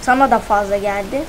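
ordinary delivery

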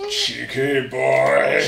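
very slow, drawn-out imitation of the actor; imitating Doug Bradley. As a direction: drag it out like a glaring horror actor. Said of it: "And he was like".

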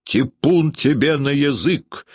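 high, slow retort; drawn-out indignant cry; acted yell without much volume; angry shout. A narrator's reading. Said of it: "кричал Гудаевский".

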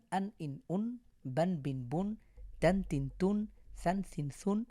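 short syllables closed on n; tanween with final n. Shown as a demonstration. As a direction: add a clear n to each short vowel. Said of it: "শেষে একটা দন্ত ন যোগ করে".

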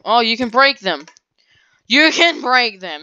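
loud excited realization; excited discovery. Real, not acted. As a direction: shout it out thrilled.

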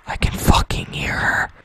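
hushed whisper so she won't hear. Real, not acted. Player hides from the siren, whispering. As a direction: breathe it out unvoiced.

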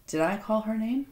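ordinary talk, nothing marked

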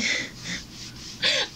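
high airy giggle; breathless laugh. Real, not acted. Laughing at herself over the fish bones, breathy giggle. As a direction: let a breathy laugh slip out. Laughs at her own complaint.

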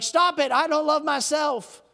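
loud, high-pitched acted outburst; angry retort. Person arguing back at Jesus at the door, angry retort. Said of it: "Can you imagine that angry conversation".

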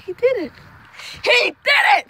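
high, preacher-style testifying; hammed-up church shout of praise. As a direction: testify like a preacher, pitch lifted.

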